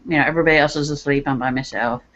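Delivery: usual speaking voice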